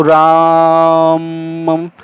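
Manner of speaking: राम with the आ drawn out very slowly; prolonged vowel. Shown as a demonstration. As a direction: stretch the आ of राम long. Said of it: "ऐसे ये माँ का जो है उच्चारण बड़ा कर देगा". (राम का आ लंबा करके बोलें)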